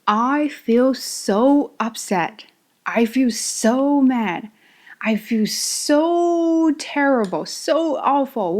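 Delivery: very slow, drawn-out exasperation; upset, mock screaming. Shown as a demonstration. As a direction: drag out each SO in dismay. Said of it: "I would scream".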